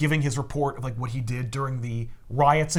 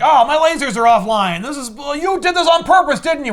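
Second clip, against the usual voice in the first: high, loud villain's dawning realization; higher, louder mock villain accusing; Luthor realizing; 9 semitones higher, 7 dB louder than usual. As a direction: sneer it as the penny drops. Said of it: "Luthor realizes like".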